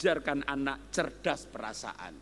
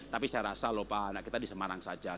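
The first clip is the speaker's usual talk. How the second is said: low, soft wife answering; wife softly disagreeing; wife's voice; 8 semitones lower, 7 dB quieter, faster than usual. Acted. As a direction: softly counter the husband. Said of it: "husband thinks, wife feels".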